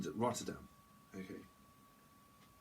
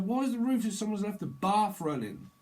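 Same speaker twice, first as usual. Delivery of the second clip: exasperated; 9 semitones higher, 5 dB louder than usual. Acted. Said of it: "It's like".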